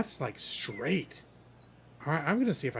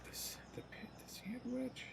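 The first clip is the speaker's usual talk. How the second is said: whispering; 9 semitones higher, 20 dB quieter than usual. Real, not acted. Quiet aside while choosing club.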